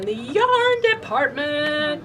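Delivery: stretched, high-pitched showy announcement; sing-song announcing. Pretend. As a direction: draw YARN out high and playful. Drawn-out theatrical welcome to department.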